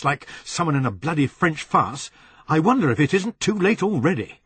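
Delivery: loud, rash angry rant; rash anger. Acted. Anthony angrily warning Helen on the phone, loud rant. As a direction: lash out in anger. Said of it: "Anger made him rash".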